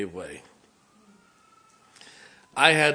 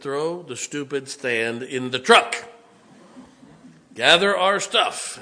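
impatient order; 2 semitones higher, 6 dB louder, slower than usual. Retold or imitated. Retold impatient order to Aaron.

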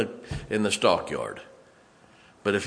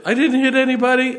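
high, louder wife protesting; wife's voice; 8 dB louder than usual. Retold or imitated. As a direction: squeak it out as the rattled wife. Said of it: "Who did you hit?".

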